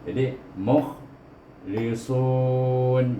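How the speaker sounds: mukhlisun with airy sad; very slow mukhlisun, hissed s; over enunciating. Shown as a demonstration. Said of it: "sad itu ada hamas".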